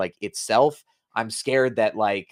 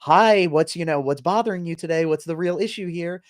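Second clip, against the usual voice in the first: high, bright customer service voice; sweet helpful customer service voice; 7 semitones higher, 3 dB louder than usual. Retold or imitated. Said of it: "put on your customer service voice".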